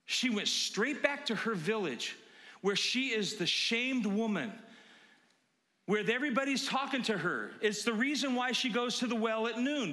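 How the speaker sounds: normal talking voice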